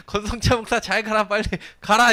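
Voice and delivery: high, loud taunting farewell; mocking jeer. Heckler sending the pastor away, high loud taunt. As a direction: shout him off with mock glee. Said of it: "이러면서 막 돌이랑 막 이것저것 막 던지기".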